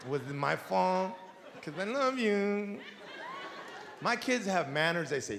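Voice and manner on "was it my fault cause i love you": soft, slow coddling parent voice; mock-tender modern parent; lightly voiced gentle parent, hushed and slow; soft understanding parent. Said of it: "today's parents ... like".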